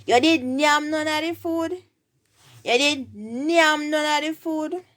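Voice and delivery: high-pitched Jamaican Patois question. Shown as a demonstration. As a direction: ask it in Patois, lifted high for learners. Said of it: "In patois, you would ask".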